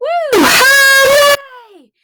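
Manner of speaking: high, loud woo-hoo and hooray; big excited whoop; loud cheer. Acted. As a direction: cheer like a party. Cheer in the song.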